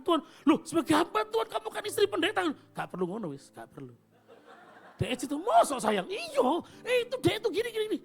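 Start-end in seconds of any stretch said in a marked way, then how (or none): 5.0-8.0 high, giggly sweet wife; playful wife voice. Retold or imitated. Pastor voices his wife's giggly teasing, high-pitched. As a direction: coo it like a flirting wife, laughing.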